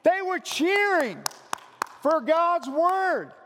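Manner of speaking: loud, high, slow triumphant proclaiming; excited shout. Retold or imitated. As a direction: shout it like the crowd is cheering again. Crowd erupting in applause.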